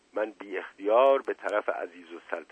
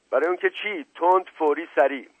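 high, raised interrogator's voice; impatient demand; 7 semitones higher, 5 dB louder than usual. A narrator's reading. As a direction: cut in and press for the answer. Inspector cuts in on confession.

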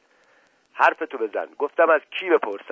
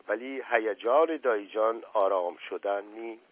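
ordinary delivery

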